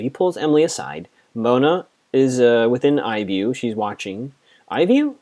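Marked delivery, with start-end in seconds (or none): 4.7-5.2 high, amused question at own phrasing; incredulous. Real, not acted. Vlogger mocking her own word choice, high incredulous. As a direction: pitch way up, doubting the word.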